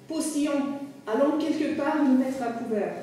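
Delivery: calling out. A narrator's reading. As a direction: hail someone and urge them on. Landlady calls to the driver.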